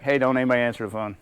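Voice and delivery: loud call across the office; call-out warning. Retold or imitated. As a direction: warn the whole room, loudly. Warning coworkers about abusive caller.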